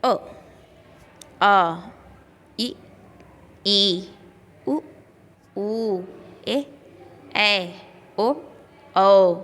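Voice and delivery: each vowel sound said clearly and separately; teacher's drill of अ, आ, इ, ई, one sound at a time; reciting the vowels. Shown as a demonstration. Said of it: "अ देखो किस तरह पढ़ेंगे".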